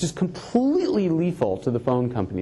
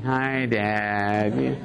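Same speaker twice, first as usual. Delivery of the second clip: put-on lower, bored flat kid greeting; couldn't care less; blasé kids; 5 semitones lower than usual. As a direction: kids greeting dad on video, totally unimpressed. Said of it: "completely blasé".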